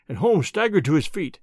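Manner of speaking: usual speaking voice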